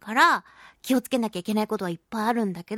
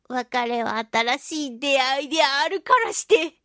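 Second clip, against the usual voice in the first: ordinary talk, nothing marked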